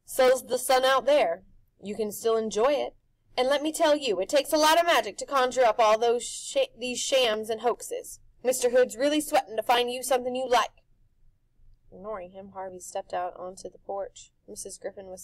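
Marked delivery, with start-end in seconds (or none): 0.0-10.8 loud, high showman pitch; pushy grinning tempter; Rictus's brash voice. A narrator's reading. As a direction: sell it like a carnival barker. Rictus persuading.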